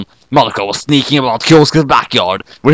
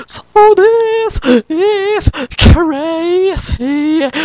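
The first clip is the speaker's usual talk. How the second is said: put-on much higher, slow drawn-out girl voice; much slower than usual. Kyoko apologising to Sayaka's body, high slow girl voice. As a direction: stretch it out like a silly song-quote.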